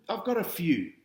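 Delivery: usual speaking voice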